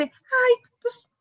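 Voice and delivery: hammed-up scolding mother brushing off sadness; mocking dismissive mother. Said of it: "A ver, no". (imitando a una mamá que minimiza, voz más aguda)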